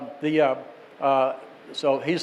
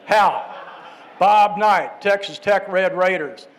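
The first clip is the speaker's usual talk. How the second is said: Bobby Knight imitation; 3 semitones higher, 3 dB louder, slower than usual. Caller insists to doubting host, loud Bob Knight impression. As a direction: announce yourself firmly as the coach. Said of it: "That's a really good imitation".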